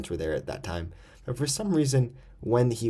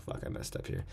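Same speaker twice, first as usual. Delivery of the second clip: under-the-breath whisper to self; hushed, voiceless aside; 12 dB quieter than usual. Real, not acted. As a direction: whisper it as a quick self-correction. Self-aside after a slip.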